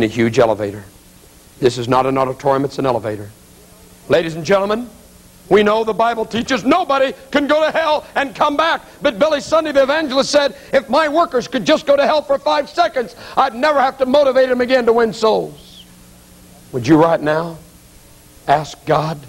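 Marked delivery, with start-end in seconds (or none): 4.1-15.8 high-pitched, ringing pulpit cry; full-force preaching. Real, not acted. Evangelist warns congregation about hell, full-force high preaching. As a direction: preach it at the top of your range.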